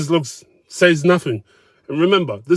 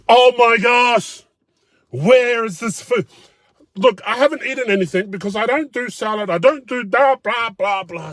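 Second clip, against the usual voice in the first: whiny mock diner; 10 semitones higher than usual. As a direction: ham up a spoiled customer's whine. Mocking the whining diner.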